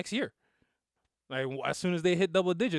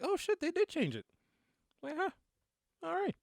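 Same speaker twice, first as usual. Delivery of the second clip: high-pitched startled "oh shit" realization; retold surprise; 14 semitones higher, 3 dB quieter than usual. Recalling spotting the changed title with his son, high surprised exclaim. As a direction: catch it just as it vanishes, surprised.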